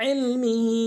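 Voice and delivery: ইলমিহি with the vowel stretched three alif long; drawn three beats. Shown as a demonstration. Teacher demonstrating a Quran word, ইলমিহি stretched three beats. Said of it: "তিন আলিফ টানবো".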